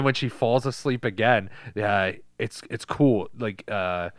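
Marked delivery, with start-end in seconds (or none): none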